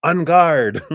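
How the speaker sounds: a pretend duelist throwing down the gauntlet; mock fencer's challenge. Drawing a pretend French sword on a coworker, a hammed-up fencing challenge. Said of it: "our little French sword and going".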